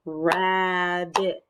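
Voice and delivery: RAB, BIT as two beats; syllable by syllable. Shown as a demonstration. Teacher clapping words for children, "Rabbit" split in two. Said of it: "clap out parts of the words".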